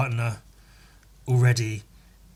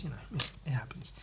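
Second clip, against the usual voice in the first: fast unvoiced whisper; whispered aside; 13 dB quieter, much faster than usual. Vlogger whispering that good ideas happen. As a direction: toss it off under your breath.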